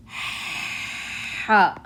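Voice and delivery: raspy ح, ha pushed from the top and back of the throat. Shown as a demonstration. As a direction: rasp the ha from the throat. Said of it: "That was a raspy sound".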